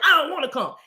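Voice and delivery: put-on high, protesting kid voice; balky child rage. Rage refusing to come out, mock balky child. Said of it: "rage. Like".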